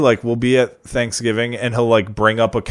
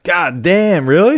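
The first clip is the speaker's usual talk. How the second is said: impressed surprise; 6 semitones higher, 4 dB louder, slower than usual. Real, not acted. Friend impressed by cooking.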